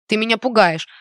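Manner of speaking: usual speaking voice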